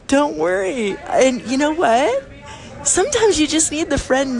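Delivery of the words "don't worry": reassuring. Retold or imitated. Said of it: "And I was like".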